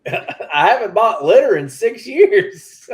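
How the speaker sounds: high and loud, stretched with amusement; loud laughing joke. Real, not acted. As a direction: call it out through real laughter. Joking punchline amid laughter.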